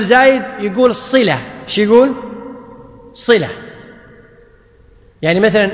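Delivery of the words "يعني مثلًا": usual speaking voice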